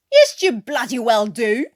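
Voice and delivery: loud furious retort; angry shout. Acted. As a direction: slam the accusation back. Newkirk accuses Watkins.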